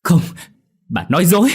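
high, loud furious scream; furious shout. A narrator's reading. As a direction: scream it in rage. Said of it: "điên tiết hét lớn".

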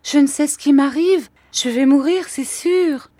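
high, loud, panicked wail. A narrator's reading. Mother sure she is going to die, panicked. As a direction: pitch it high, overwhelmed with alarm. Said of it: "balbutia ma mère".

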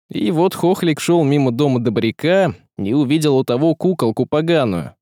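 ordinary delivery

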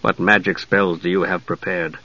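low, soft, controlled question; cool. A narrator's reading. Knight questions the mage before a fight, cool and low. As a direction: ask coolly, under your breath. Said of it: "Steele said coolly".

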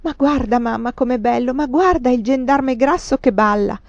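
put-on high, loud delighted child; delighted boy at the theatre; ringing laugh. A narrator's reading. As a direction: call to mama, thrilled by the show. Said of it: "con squillante risata".

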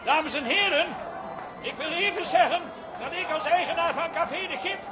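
public address. Acted. Addresses angry crowd outside.

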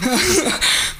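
a quick amused laugh; short laugh. Real, not acted. Laughing when the host says she doesn't believe it, a short laugh. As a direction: laugh it off, caught out.

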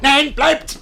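high, loud stop order; sharp shout. Acted. Master stops servant from going, sharp shout. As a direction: halt him.

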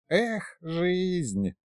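soft, high, long-drawn lament; softly drawled complaint. A narrator's reading. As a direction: stretch it out like a weary old sigh. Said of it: "протянул колдун".

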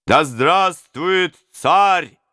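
very slow, high, loud shout of the toast; crowd repeating toast. Acted. Robbers echoing the prince, very slow high shout. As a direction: drag it out as a chorus. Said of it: "повторили разбойники".

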